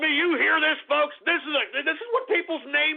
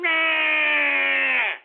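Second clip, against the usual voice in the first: mock crying wail; 4 semitones higher, 4 dB louder than usual.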